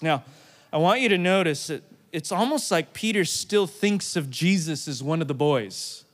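ordinary talk, nothing marked

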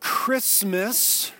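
"Christmas" drawn out and set apart; stress on Christmas. Real, not acted. Preacher reclaiming the word for the church, emphatic "Christmas". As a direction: lean deliberately on the word "Christmas". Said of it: "I put the emphasis on the word".

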